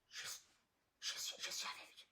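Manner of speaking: breathy secret whisper. Retold or imitated. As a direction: hush it like a confession. Cousin whispers he is with her.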